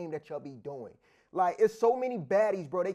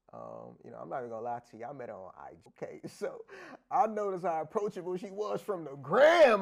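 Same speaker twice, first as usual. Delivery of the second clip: ordinary talk, nothing marked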